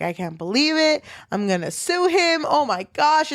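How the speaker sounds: retold outrage, pitch climbing; mildly acted-out meltdown; losing it. Wife hearing the will was changed, losing it. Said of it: "she fucking loses it".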